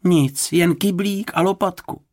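high-pitched anxious fretting; worried. A narrator's reading. Worried woman listing what he had, anxious. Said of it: "strachovala se paní Fousková".

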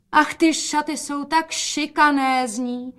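high, slow admiring cry; exclaiming. A narrator's reading. Mother admires the gown, exclaiming. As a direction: exclaim over it, drawing out the words. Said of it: "zvolala matka".

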